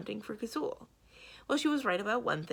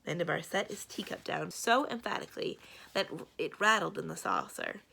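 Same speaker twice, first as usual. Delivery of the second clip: ordinary delivery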